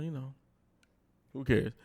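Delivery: usual speaking voice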